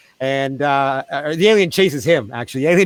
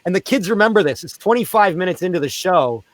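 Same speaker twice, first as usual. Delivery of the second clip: usual speaking voice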